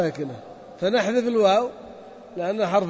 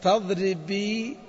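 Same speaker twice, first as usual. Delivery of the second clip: slow demo; much slower than usual. Shown as a demonstration. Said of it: "ياء مؤنثة مخاطب".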